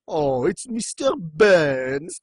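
very slow, drawn-out cartoon realisation; mock dawning horror. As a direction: stretch the name out in hammed-up recognition. Crowd reacts to the name.

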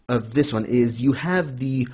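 usual speaking voice

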